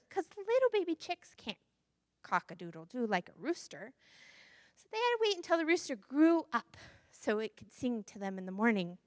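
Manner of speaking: usual speaking voice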